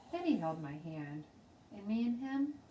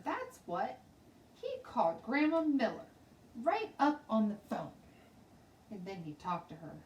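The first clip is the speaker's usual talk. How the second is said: ordinary delivery, nothing marked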